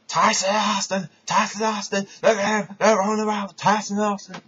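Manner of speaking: imitating a wild wrestling commentator; J R commentating. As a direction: lose it like an overexcited ringside commentator. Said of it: "J R's having an orgasm going".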